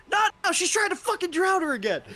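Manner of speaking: high, loud panicked yelling from far off; frantic distant shout. Acted. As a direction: scream it in a panic through a portal. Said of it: "you just hear".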